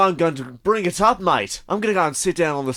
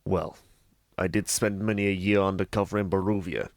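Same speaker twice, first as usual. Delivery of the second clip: gangster; 7 semitones lower, 4 dB quieter than usual. Acted. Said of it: "Gangster movie".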